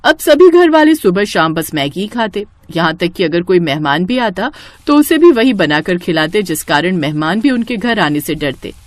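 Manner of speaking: ordinary delivery, nothing marked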